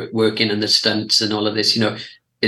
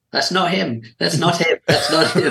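mock spotting the double; 6 semitones higher than usual. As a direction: point out the stunt double, half-laughing. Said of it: "sometimes you just see".

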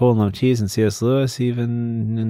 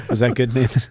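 normal talking voice

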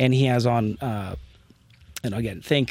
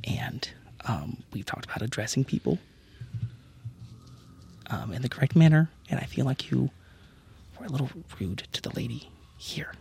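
whispered aside; 9 dB quieter than usual. Acted. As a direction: murmur a gentle reprimand privately. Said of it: "lean into the ear of the general".

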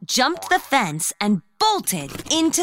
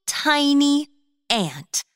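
ordinary delivery

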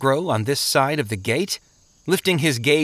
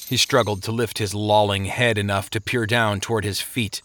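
usual speaking voice

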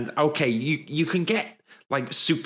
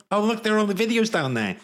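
higher, quicker excited aside; suddenly distracted and thrilled; 8 semitones higher, faster than usual. Real, not acted. Spots videos in the world.

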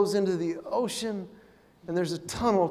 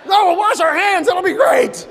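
mock excited reply; 8 semitones higher, 7 dB louder than usual.

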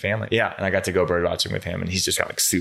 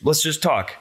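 imitating the professor; 10 semitones higher than usual. Professor voiced in story.

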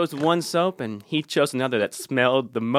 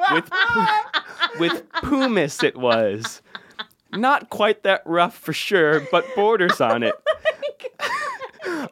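laughing; 13 semitones higher, slower than usual. Real, not acted. Reading review, cracking up.

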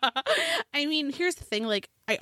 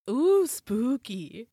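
mock spooky; 2 semitones higher, much slower than usual. Mocking a scary story.

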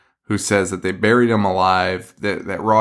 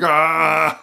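mock pained yelp; 6 semitones higher than usual. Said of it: "they're like".

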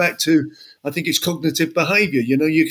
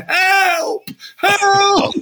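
high, crying shouts for help; retold screaming; 12 semitones higher than usual. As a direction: scream it like you're drowning on the dance floor. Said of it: "ninety seconds of me screaming".

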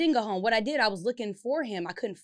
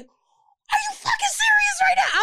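much higher, louder, slow, near tears; outraged disbelief; 8 dB louder, slower than usual. Retold or imitated. To the officer who is arresting her, an outraged crying shout.

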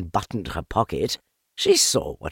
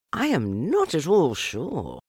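thoughtful; 7 semitones higher than usual. A narrator's reading. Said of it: "in a thoughtful voice".

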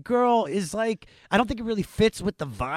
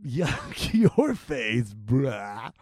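very slow, stretched teasing; slow drawn-out; 5 semitones lower, much slower than usual. Pretend. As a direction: drag every word out lazily. Drawn-out mock insult.

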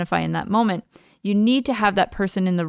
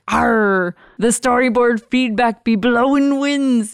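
lightly voiced pirate, louder; a hint of a pirate captain; 3 semitones higher, 5 dB louder, slower than usual. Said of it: "just like you did".

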